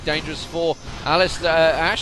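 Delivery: loud, fast, high commentary; excited play-by-play. Real, not acted. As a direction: call the fight as it happens. Fight breaks out, live call.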